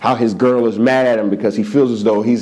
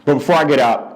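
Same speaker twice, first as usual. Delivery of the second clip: ordinary talk, nothing marked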